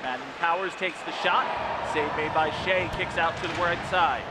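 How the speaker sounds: play-by-play. Acted. Live play-by-play.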